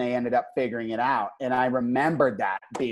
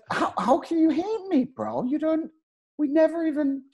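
high-pitched acted disbelief; protest, baffled and much higher; incredulous protest; 13 semitones higher than usual. Acts out hurt disbelief at being hated.

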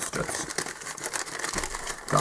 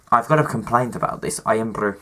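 ordinary talk

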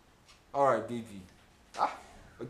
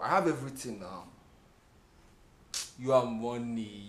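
usual speaking voice

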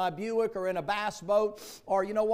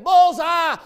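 Joe calling out; 9 semitones higher, 14 dB louder than usual. Retold or imitated. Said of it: "He'd get".

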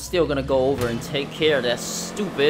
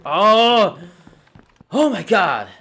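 loud, high frustrated cry; real fury at the game; fuming gamer yelling; exasperated outburst; 7 semitones higher, 9 dB louder, much slower than usual. Real, not acted. Angry after death in game.

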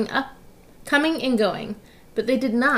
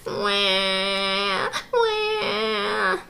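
mock baby crying, wah wah; baby wail. Woken babies crying at the detectives, mock wailing. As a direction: wail like a fussing infant. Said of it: "they cried".

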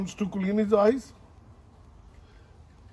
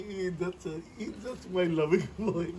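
normal talking voice

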